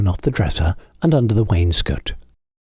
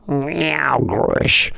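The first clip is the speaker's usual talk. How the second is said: cat noises; 7 semitones higher than usual. A narrator's reading. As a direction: make a strange night cat noise. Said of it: "strange horrid noises as cats".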